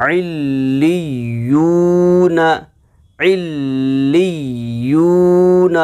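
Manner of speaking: इल्लियून slow and joined, long l and long oo; flowing recitation. Shown as a demonstration. Teacher moves from spelling to flowing reading, इल्लियून drawn out. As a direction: stretch इल्लियून in one sustained breath. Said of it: "हम इसको रवानी पढ़ते हैं".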